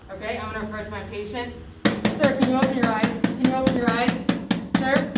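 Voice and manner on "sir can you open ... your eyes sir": loud, firm call to rouse someone; calling out loudly. Acted. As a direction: call out to wake the patient. Calling to an unresponsive patient.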